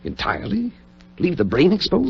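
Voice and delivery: can't believe the order; shocked question. Acted. Friend shocked at the surgeon's order, high and incredulous.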